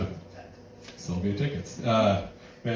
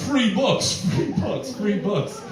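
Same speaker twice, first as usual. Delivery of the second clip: mock library ad; 9 semitones higher, 3 dB louder, much slower than usual. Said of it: "you're like".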